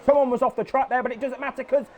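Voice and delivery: lively live play-by-play; excited sim racing commentator; race commentary. Acted. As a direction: call the race as it happens.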